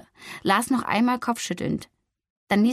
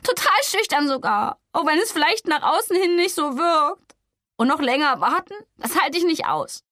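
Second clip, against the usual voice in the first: higher, louder, agitated retort; upset retort; 7 semitones higher, 3 dB louder than usual. Acted. Flori arguing with Gregor, agitated and overwhelmed. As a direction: push back, overwhelmed. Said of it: "fuhr sie ihn an".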